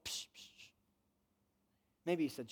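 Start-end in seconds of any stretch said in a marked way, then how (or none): 0.1-0.7 soft breathy hushing sound; shushing. Retold or imitated. Holy Spirit hushing a self-condemning believer, soft shush. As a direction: hush them gently with a psh. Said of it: "the Holy Spirit would go".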